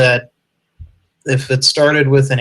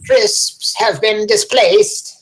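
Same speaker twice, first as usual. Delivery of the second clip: high-pitched silly British newsreader; high, hammed-up British announcement; mock British voice; 9 semitones higher than usual. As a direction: join in with a put-on British accent. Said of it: "it'd be some British guy".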